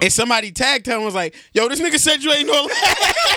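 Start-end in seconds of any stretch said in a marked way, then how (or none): 1.5-3.4 high, laughing retold quote; cracking up while quoting. Real, not acted. Laughing through retold tag.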